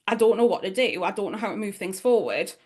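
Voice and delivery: ordinary talk, nothing marked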